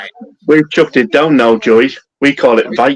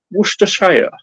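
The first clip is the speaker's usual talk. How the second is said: higher-pitched posh southern English Worcestershire; posh Southern schoolboy; 7 semitones higher than usual. Shown as a demonstration. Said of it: "if you're posh Southern, uh, schoolboy".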